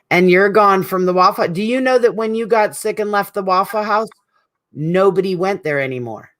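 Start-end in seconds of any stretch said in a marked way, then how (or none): none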